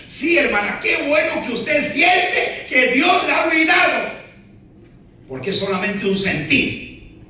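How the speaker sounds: high, loud preaching shout; pastor's shout. Retold or imitated. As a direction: proclaim it loudly. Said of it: "pastor answers her".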